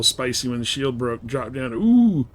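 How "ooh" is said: ordinary delivery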